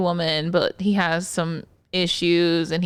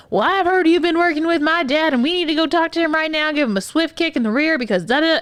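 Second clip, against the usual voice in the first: pushy son; 8 semitones higher than usual. Retold or imitated. Therapist mimicking the patient's demanding son, blustering. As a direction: play the pushy son barging in. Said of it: "he's like".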